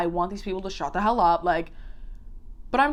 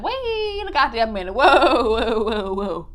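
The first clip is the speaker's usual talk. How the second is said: losing it; 2 semitones higher, 4 dB louder, slower than usual. Retold or imitated. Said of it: "I just about lost it. I said".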